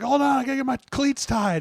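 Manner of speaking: high-pitched mock panic; flustered player. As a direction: plead for a moment in a high, flustered voice. Acting player scrambling to get dressed.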